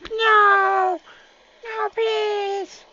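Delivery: high-pitched unit begging; high squeaky pleading. Pretend. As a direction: squeal no in a tiny voice. Units dying.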